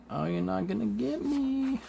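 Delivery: high-pitched taunt with a stretched end; Shriek. Retold or imitated. Shriek defying Cloak's capture, high mock taunt. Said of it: "she's like".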